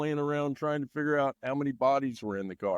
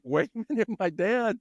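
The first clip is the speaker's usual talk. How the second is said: high, wide-eyed disbelief; amazed realization; 8 semitones higher than usual. Retold or imitated. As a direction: realize it's really dad watching. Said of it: "I'm like".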